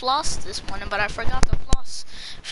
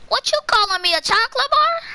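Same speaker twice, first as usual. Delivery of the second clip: mock outrage; 11 semitones higher than usual. Mock outrage at chat insult.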